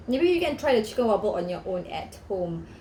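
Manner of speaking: plain reading voice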